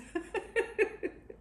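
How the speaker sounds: short excited giggle; a quick high laugh. Real, not acted. Laugh before tasting.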